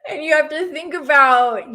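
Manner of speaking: breaking into laughter mid-sentence; laughing. Real, not acted. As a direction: laugh through the words. Laughing at butterfly interrupting.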